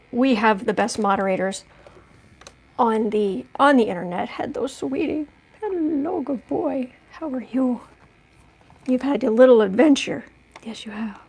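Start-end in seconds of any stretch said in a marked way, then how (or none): 4.3-7.8 softer cooing greeting to her dog. Real, not acted. 10.6-11.2 much softer cooing reply; hushed affectionate words to the dog; soft pet talk. Real, not acted. Talking to her dog.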